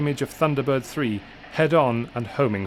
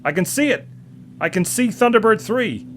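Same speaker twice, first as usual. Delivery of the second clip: higher, louder jubilant shout; excited yell; 10 semitones higher, 5 dB louder than usual. A narrator's reading. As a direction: shout with sudden joy. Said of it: "he gave an excited yell".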